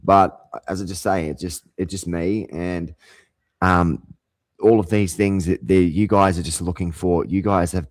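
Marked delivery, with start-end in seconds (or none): none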